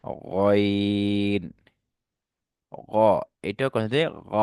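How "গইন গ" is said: গইন held very long, gargled in the throat; গড়গড়া করে গইন; correct ghain. Shown as a demonstration. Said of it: "গড়গড়া".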